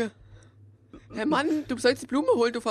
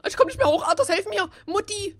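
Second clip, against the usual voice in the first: high loud hammed-up cries for help; mock panic; 9 semitones higher, 5 dB louder than usual. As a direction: wail for help like a stranded kid. Steckt fest, ruft um Hilfe. (gespieltes panisches Hilferufen)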